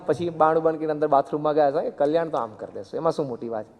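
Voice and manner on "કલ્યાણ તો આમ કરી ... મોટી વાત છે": barely bother, the most effortless shrug in words; utterly casual. Retold or imitated. Guru repeating his promise of salvation, utterly casual. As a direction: lower and softer, Kalyan dropped lightly as a trifle. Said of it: "અતિ સાહજિક".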